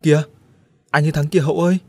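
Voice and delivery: excited cry. Acted. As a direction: shout the discovery with glee. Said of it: "Đĩnh reo". (reo lên mừng rỡ)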